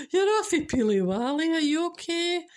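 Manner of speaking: mock-worried Scottish neighbour; concerned Scottish auntie. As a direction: fuss over someone looking pale. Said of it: "you will hear them saying".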